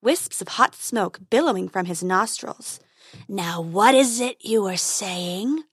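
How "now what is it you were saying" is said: slow menacing dragon voice; growling dragon. Acted. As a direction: drawl it like a bored dragon. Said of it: "growled the dragon".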